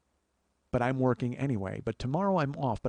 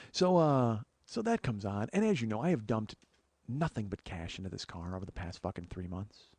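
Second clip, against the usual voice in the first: ordinary delivery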